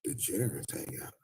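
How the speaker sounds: hushed degenerates; whisper. Real, not acted. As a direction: whisper the word. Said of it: "whispered word".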